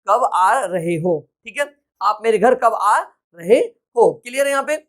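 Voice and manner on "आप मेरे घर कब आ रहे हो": normal talking voice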